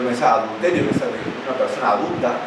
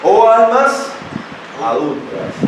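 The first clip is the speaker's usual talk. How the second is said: high, loud opening cry, dropping on the last word; confronting reproof; 9 semitones higher, 5 dB louder, slower than usual. Retold or imitated. Preacher reads the apostle's rebuke to the congregation, confronting. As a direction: call it out as a stern rebuke. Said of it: "diciendo una declaración, una confrontación, una reprensión".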